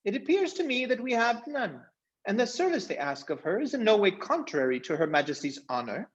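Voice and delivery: high, ringing, noble declaration; gallant mouse knight. A narrator's reading. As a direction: proclaim her act heroic like a proud little knight. Reepicheep speaks nobly.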